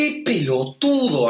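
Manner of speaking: slow, drawn-out "pelotudo"; emphatic insult. Shown as a demonstration. Friend showing how the insult describes someone, slow emphatic "pelotudo". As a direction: lean on the syllables of "pelotudo". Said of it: "te aumentan la palabra".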